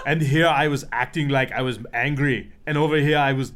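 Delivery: lightly voiced Arnold, laughing through it; a hint of Arnold's accent, loud and amused; Arnold Schwarzenegger impression. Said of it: "That was a very good impression".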